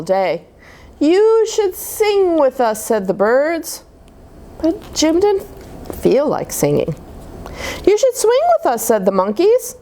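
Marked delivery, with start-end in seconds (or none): 1.0-2.8 high slow birdsong voice. Acted. Birds invite Jim. 7.8-8.8 high monkey voice. Acted. Monkeys invite Jim.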